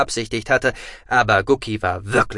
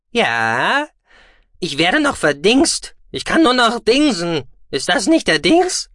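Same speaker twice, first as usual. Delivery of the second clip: high, loud squeaky rant; agitated, squeaky creature voice; exasperated small creature; 8 semitones higher, 6 dB louder than usual. A narrator's reading. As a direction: complain loudly in a high little voice. Small creature rants at him.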